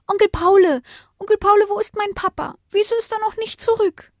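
a little pup asking after his father; small child. Acted. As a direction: plead like an anxious little pup. Child pup asks for papa.

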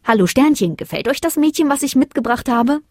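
high, lilting question; cheerful address. A narrator's reading. Moon presents the girl to stars, cheerful address.

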